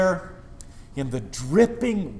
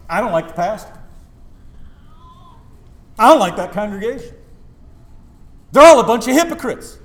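rising into a loud, higher mock rant; mock complaint; 8 semitones higher, 12 dB louder than usual. Pastor imitates a churchgoer griping about church, building mock rant. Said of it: "it goes like this".

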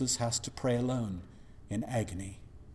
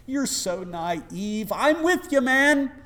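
much higher and louder cocky vow; mock boastful believer; 14 semitones higher, 16 dB louder than usual. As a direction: ham up the overconfident disciple. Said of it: "We talk like this".

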